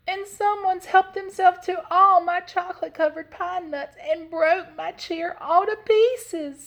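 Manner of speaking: high, loud cub wail; Little Honey. A narrator's reading. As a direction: squeal the outrage in a little bear voice. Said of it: "squealed Little Honey in his little voice".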